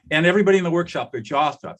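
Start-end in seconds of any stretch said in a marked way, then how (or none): none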